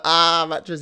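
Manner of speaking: loud, high taunt; teasing. Retold or imitated. Child teasing older brother again, loud and mocking. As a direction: needle him in a mocking call. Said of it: "I love teasing him".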